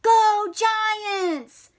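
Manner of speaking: high, loud rallying yell; yelled cheer. Acted. Coach yelling to her team, loud cheer. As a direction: shout to get the team going. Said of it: "she yelled".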